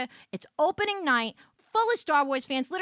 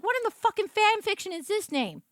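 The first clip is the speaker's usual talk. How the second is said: higher, loud exasperated questioning; mock outrage at the page; outraged disbelief; 8 semitones higher, 4 dB louder than usual. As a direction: rant at the absurd name. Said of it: "I was like".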